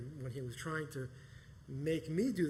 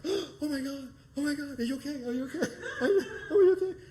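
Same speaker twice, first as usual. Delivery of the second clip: frantic high-pitched worry, half laughing and half crying; shrieking louder and higher with each "are you okay"; panicked; 12 semitones higher, 10 dB louder than usual. Retold or imitated.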